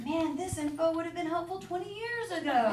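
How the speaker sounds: high-pitched comic complaint; mock exasperation. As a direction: play up the belated grievance for a laugh. Mock complaint to mother, comic punchline.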